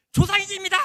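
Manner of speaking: high, very loud acted-out shout; imitated mass yell; crowd shouting in unison. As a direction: belt it out like a whole class at once. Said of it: "정말 백삼십 명이 동시에".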